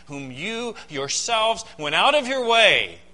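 slow accusing emphasis on "you yourselves"; stress on you yourselves. Shown as a demonstration. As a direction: drive home "you yourselves". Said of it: "is emphatic in the Greek".

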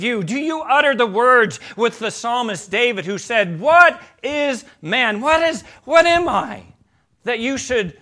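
loud, high, ringing pulpit appeal; fervent preaching. Real, not acted. Preacher challenging the congregation with the psalmist David's words, fervent and awed. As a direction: press the question on them with rising awe.

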